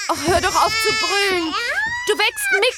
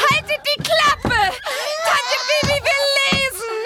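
exasperated scolding; 7 semitones higher than usual. Acted. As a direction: spell it out to the noisy babies. Bibi scolds crying babies.

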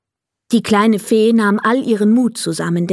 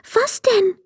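much higher, eager fairy squeak; excited fairy; 13 semitones higher than usual. Acted. Fairy eagerly asks Emil his wish, much higher and excited. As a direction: burst out with eager curiosity. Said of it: "fragte die kleine Fee aufgeregt".

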